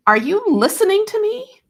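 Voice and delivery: high, loud, pleading scream; desperate scream. Retold or imitated. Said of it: "I screamed".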